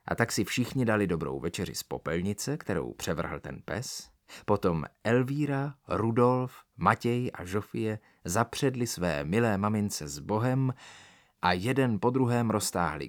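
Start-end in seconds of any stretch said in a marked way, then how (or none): none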